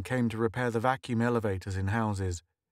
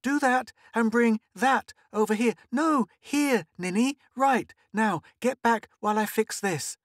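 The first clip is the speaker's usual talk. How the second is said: put-on bossy little girl; 12 semitones higher, 4 dB louder than usual. Girl bossing playmates.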